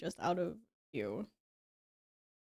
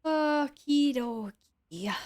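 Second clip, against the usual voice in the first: slow, stretched and louder okie dokie; drawn-out okie dokie; 5 semitones higher, 7 dB louder, much slower than usual. Pretend. Streamer to chat, stretched slow and loud. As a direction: drag the words out playfully.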